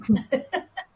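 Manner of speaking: a bright, knowing laugh. Real, not acted. Former student recalling the grind of study, laughing. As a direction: laugh as you remember it well.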